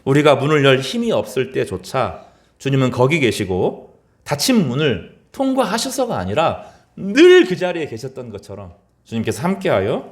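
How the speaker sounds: ordinary delivery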